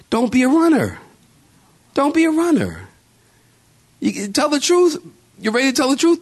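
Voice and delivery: high-pitched, insistent preacher's exhortation; impassioned preaching. Real, not acted. Preacher warning congregation about running without the truth, impassioned and high.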